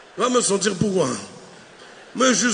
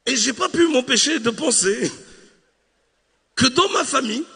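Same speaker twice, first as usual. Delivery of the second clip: ordinary talk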